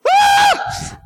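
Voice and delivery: sudden very high-pitched shriek; mock terrified scream. Person startled by an angel appearing, mock scream. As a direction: let out a hammed-up scream of fright.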